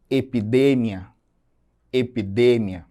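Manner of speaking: "epidemia" stressed on DE, the Spanish way; Spanish "epidemia" with a strong third syllable. Shown as a demonstration. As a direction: say e-pi-DE-mia for "epidemia". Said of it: "a sílaba D".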